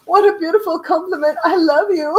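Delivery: high, loud, gushing mock delight; answering her daughter's joke with mock gratitude; mock flattered. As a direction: ham up being flattered. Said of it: "I'm like".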